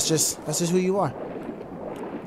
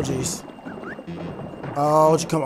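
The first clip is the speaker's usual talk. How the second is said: normal talking voice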